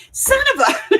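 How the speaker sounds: breaking into loud high laughter mid-phrase; laughing. Real, not acted. Wife cracks up at her husband's request, laughing. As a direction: laugh through the words. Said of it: "I was laughing so hard".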